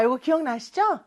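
ordinary talk

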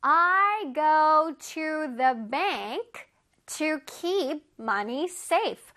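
I read books at the library, every word drawn out; slow example sentence. Shown as a demonstration. Teacher reading an example sentence slowly to kids. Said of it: "한번 읽어볼게요".

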